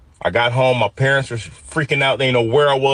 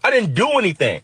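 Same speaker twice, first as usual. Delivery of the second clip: high, frightened yell of protest; 10 semitones higher than usual. Retold or imitated. In the squad car, yelling at the cops, high and desperate. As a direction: yell it out, scared and frustrated. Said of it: "and I yelled".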